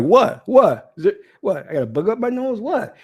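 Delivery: rising, puzzled repeated what; baffled what. Retold or imitated. Mock bafflement as the witch stares. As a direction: act confused as she stares at you. Confused reaction to the witch's look.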